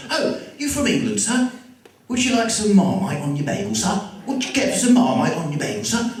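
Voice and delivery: high-pitched New Yorker's bad English accent; mock English accent. Old shop owner teasing an English customer, mock English accent. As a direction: put on a fake English accent, high and chirpy. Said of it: "he impersonates my accent".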